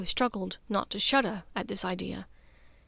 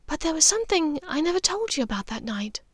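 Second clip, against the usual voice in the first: serious Narcissa; 7 semitones higher than usual. A narrator's reading. Narcissa voiced, turning serious.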